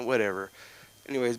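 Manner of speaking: ordinary delivery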